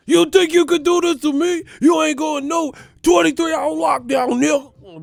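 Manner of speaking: explosive high shouted tirade; Denzel. Acted. Governor as movie cop imposing lockdown, furious high shouting. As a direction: explode like a crooked cop losing control. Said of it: "like Denzel and shit in Training Day".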